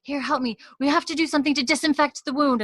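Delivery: high, urgent nurse in charge; acted brisk medical order; [name]. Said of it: "Nurse [name] mode activated".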